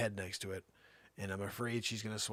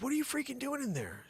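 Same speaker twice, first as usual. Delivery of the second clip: 1 alarmed dad. Retold or imitated. Dad asking daughter inside dog.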